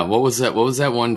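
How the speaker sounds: ordinary talk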